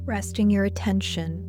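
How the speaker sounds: unhurried, measured guided-visualization voice; guided meditation. Acted. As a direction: lead listeners gently inward, leaving space between phrases.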